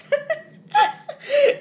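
high, loud burst of laughter; loud mocking laugh. A narrator's reading. Big brother laughs at Jonathan's dragon news, scornfully. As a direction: laugh out loud at a silly claim. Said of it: "laughed his brother".

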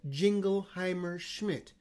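Jingleheimer Schmidt said slowly, every syllable crisp; over enunciating. Shown as a demonstration. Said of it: "I'll do it again".

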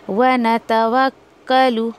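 वनतवक्कलु slow, the kaf sounded twice; वनतवक्कलु spoken slowly with a doubled k; doubled kaf demo. Shown as a demonstration. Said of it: "दो आवाज़ आएगी काफ की".

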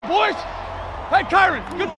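loud, high cheering yell; celebratory shout. Real, not acted. Quarterback cheering the two-point conversion, loud yell.

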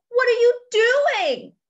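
high, loud, drawn-out question; crying out. Acted. Hippo to Duck who jumped in the pile, crying out. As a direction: exclaim it slowly in storybook astonishment. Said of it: "cried Hippo".

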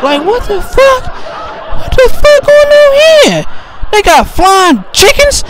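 very high, slow, wonder-struck shout; high comic shriek of astonishment; shrieking amazement. Retold or imitated. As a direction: shout like you've seen another world. Comic awe, first sex.

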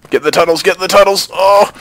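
high, loud frantic urging; panicked yell on the run; urgent shouting. Real, not acted. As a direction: shout yourself to safety. Fleeing under fire.

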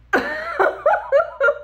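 loud high burst of laughter; laughing at the Mississippi comment; big high laugh. Real, not acted. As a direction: crack up at your own punchline. Laughing at own story.

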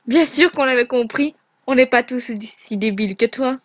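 loud furious scorn; furieux. Acted. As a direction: hurl the insult at him. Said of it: "Nevra est partie en furie". (Nevra insulte Ezarel, furieux et fort)

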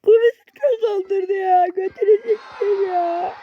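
high, slow fake sob of frustration; hammed-up crying in a high voice; mock crying whine. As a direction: whimper it like you're about to burst. Raging at a game loss.

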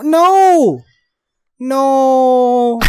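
ordinary delivery

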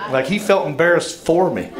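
ordinary talk, nothing marked

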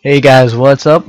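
loud cheerful intro; upbeat greeting. Real, not acted. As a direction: greet the viewers loudly.

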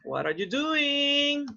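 slow, high teaching question; slow model question. Shown as a demonstration. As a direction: ask it slowly and clearly, up high. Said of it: "pues dicen así".

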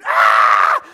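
little brother shrieking in fright; scared scream. Retold or imitated. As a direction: let out a kid's terrified yell. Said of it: "he screamed".

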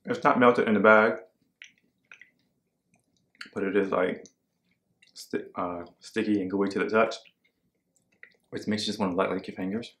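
speaking with food in mouth; pausing to chew between words; chewing while talking. Real, not acted. Seen 1: Ate popcorn at -12; lips pursed, chewing motions between phrases.

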